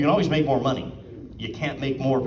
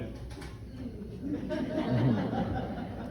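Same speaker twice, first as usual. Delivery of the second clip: soft, high, drawn-out laugh; high little laugh, held long; retold stunned laugh; 9 semitones higher, 9 dB quieter than usual. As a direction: imitate a quiet laugh of disbelief. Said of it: "And we went".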